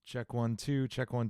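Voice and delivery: normal talking voice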